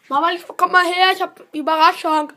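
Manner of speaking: calling out loudly to mom; yelling across the room. Real, not acted. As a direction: shout it to another room. Calling mother from another room.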